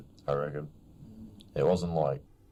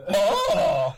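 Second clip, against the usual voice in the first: loud laughing oh, much louder and higher; mock shocked oh; 7 dB louder than usual. Imitating his friend seeing the crushed fingers, loud laughing oh. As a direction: blurt oh with a laugh. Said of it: "He's like".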